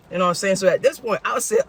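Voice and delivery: ordinary talk, nothing marked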